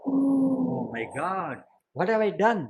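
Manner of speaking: storyteller's dismayed king, stretching the exclamation; dismayed realization. A narrator's reading. King realizes he wronged Dantila, acted dismay. King horrified at his own mistake.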